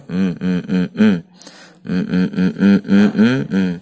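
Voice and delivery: short loud hums while he waits; humming to himself. Real, not acted. Vlogger hums to himself while checking the app, loud hums. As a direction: hum away while the app loads.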